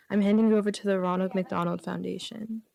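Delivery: usual speaking voice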